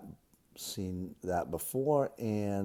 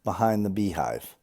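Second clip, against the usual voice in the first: loud, deliberate read-aloud; practice-sentence reading; 2 semitones higher, 9 dB louder than usual. Acted. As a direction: read each test sentence out loud and distinctly.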